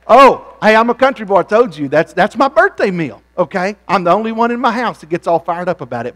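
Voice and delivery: fired up about his country meal; excited preacher. Acted.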